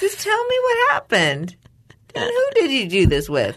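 laughing interrogator. Pretend. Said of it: "during an interrogation".

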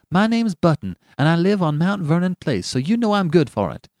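high, indignant treble protest; angry retort. A narrator's reading. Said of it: "he retorted angrily".

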